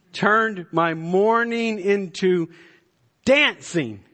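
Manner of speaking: "turned" stretched out high and loud, then "dancing" landed loudly; exultant, high and loud preacher's cry; preached exclamation. Shown as a demonstration. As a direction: proclaim "turned my mourning into dancing" with rising fervor. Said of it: "Listen to verse eleven".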